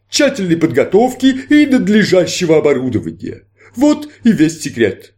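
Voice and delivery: grand stage magician, inviting; showman magician. Acted. Magician explains.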